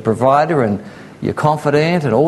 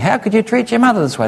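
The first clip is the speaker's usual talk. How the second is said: high, fast, indignant auntie on the phone; scolding auntie; 9 semitones higher, faster than usual. Retold or imitated. Auntie rings to scold her over her mother, high and indignant. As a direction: play the shocked aunt scolding her niece. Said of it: "Auntie's ringing up saying".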